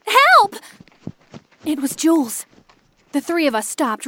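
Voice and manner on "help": high, loud cry; sudden desperate scream; scream for help. A narrator's reading. Jules is grabbed and screams, desperate. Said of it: "I heard a scream".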